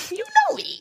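know me jumped way up high in pitch; high teasing falsetto on know me; squeaky high you know me. Acted. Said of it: "playful repeat".

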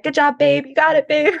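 high, cheering feminine voice. Retold or imitated. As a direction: imitate a high-pitched woman cheering on a climber. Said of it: "this feminine voice saying".

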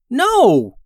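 high, loud panicked shout; yelling. Acted. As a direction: yell to stop her. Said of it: "yelled June".

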